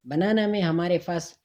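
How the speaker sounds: ordinary delivery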